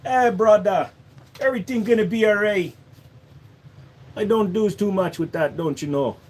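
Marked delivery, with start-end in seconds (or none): 0.0-2.8 light, laid-back Jamaican accent; a lightly done Jamaican accent. Shown as a demonstration. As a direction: soothe a friend Jamaican-style. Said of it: "when it comes to Jamaican".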